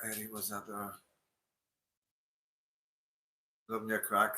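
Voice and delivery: distant and faint, far from the microphone; talking from across the room, off mic; away from the mic. Real, not acted. Streamer wanders off from his mic, far-off voice. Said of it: "I know the mic's away over there".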